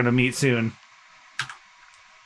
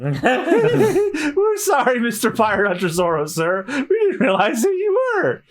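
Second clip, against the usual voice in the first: groveling apology; 8 semitones higher than usual. Acted. Pirates groveling to Zoro.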